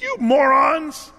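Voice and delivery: loud, high, slow shouted insult; angry yell. Retold or imitated. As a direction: hurl it at the crowd, stretched out. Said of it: "he yells at them".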